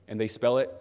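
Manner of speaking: ordinary talk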